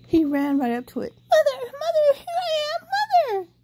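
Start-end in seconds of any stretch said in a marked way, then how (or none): 1.1-3.5 high, loud laughing calls; running up laughing with relief; joyful baby bird. A narrator's reading. Baby bird runs to the big thing, laughing high and loud. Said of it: "he said to the big thing".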